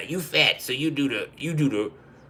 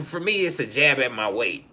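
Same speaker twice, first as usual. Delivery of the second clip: usual speaking voice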